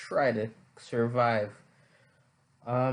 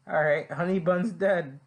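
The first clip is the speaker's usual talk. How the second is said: usual speaking voice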